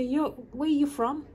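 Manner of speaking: high-pitched quote of a curious teaser; amused questioning. Retold or imitated. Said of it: "they used to laugh".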